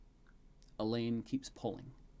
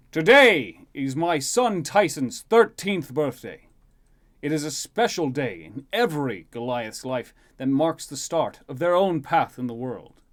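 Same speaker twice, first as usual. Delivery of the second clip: loud, raised ceremonial oratory; proud king's speech; 4 semitones higher, 12 dB louder than usual. Acted. King at his son's birthday banquet, proud oratory. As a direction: address a great hall with stately emphasis.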